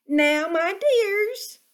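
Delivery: high, slow motherly rabbit; lilting mother rabbit calling her little ones. A narrator's reading. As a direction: coo it like a fond old mother rabbit. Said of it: "said old Miss Rabbit".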